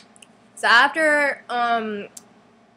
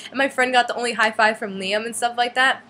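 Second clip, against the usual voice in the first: usual speaking voice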